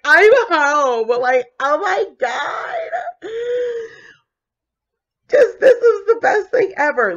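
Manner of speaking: high, ecstatic squeal; overjoyed. Retold or imitated. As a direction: gush with relief. Delight at finding her job.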